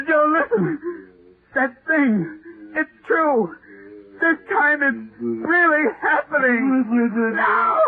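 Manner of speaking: a writer crying to Joan that the monster is real; terrified pleading. Acted. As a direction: scream the warning, slow and shaking on the last. Monster returns, calls to Joan.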